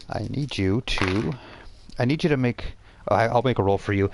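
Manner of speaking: ordinary talk, nothing marked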